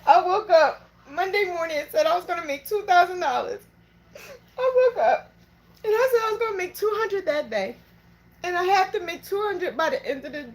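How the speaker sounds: fighting back real tears as she speaks; emotional, close to tears. Real, not acted. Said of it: "I'm so sorry I'm emotional".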